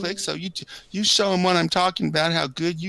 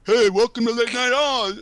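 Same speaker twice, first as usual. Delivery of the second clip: higher mock host intro, hammed up; Don A impression; 7 semitones higher, 3 dB louder than usual. Friend attempts the stream host's opening line, mock announcer. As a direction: announce the show like a bad imitation. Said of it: "I tried".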